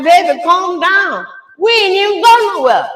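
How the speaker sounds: high, loud and urgent; calming her daughter while panicking herself; frantic mom. Retold or imitated. Mother yells at laboring daughter to calm down, high and loud. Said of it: "I said".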